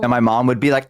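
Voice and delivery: ordinary talk, nothing marked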